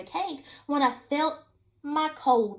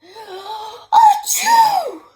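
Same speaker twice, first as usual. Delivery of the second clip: gasping wind-up, then a loud, high achoo; hammed-up giant sneeze with a sharp intake first; huge sneeze; 10 semitones higher, 14 dB louder than usual. Wolf's sneeze blowing down the straw house, loud and hammed up. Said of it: "I sneezed a great sneeze".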